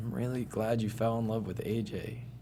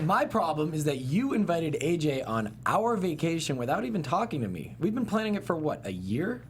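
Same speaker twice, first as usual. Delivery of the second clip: angry; 6 semitones higher, 4 dB louder than usual. Acted. Angry confrontation over vacation.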